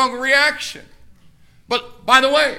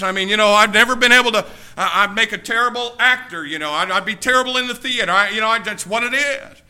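normal talking voice

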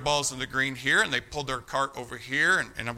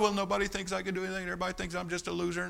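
soft, rushed self-pitying whine, acted; quick, quiet mock self-pity; mock martyr; 2 semitones higher, 9 dB quieter, faster than usual. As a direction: imitate someone feeling sorry for themselves. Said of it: "get a martyr's mentality".

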